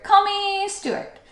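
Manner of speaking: high, drawn-out eager plea; insist, stretching the words out high; crying out. A narrator's reading. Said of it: "cried Stuart".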